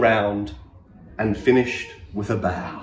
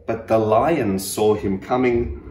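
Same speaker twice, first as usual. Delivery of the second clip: plain reading voice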